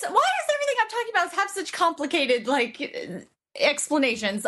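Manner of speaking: high-pitched exasperated complaint. Real, not acted. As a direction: throw up your hands at your own tangled explanation. Exasperated at own tangled explanation.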